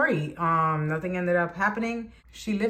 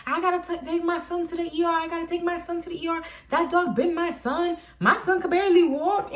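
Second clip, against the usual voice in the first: high-pitched mother harping on; mocked yabbing; 7 semitones higher than usual. Storyteller mimics the mother's ER drama, high mock yabbing. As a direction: parody her endless complaint up high. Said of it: "Keeps on yabbing, yabbing".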